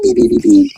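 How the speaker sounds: high stooge-style beeping noise; Shemp beeping. Retold or imitated. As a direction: beep like a Three Stooges gag. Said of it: "like Shemp of Three Stooges".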